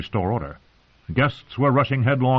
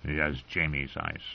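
much softer, lower, slow; hushed inner thought; 7 semitones lower, 11 dB quieter than usual. Acted. Tyrion thinks to himself, soft and slow. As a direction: murmur it to yourself.

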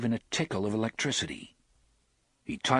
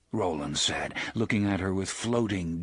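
ordinary delivery, nothing marked

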